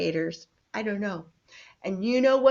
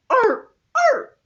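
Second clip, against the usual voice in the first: seal bark; 11 dB louder than usual. Shown as a demonstration. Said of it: "And seals are more like".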